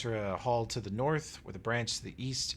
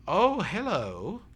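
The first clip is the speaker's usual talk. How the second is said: half-orc woman greeting; 7 semitones higher, 6 dB louder, slower than usual. Acted. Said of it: "and she goes".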